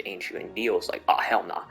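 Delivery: ordinary delivery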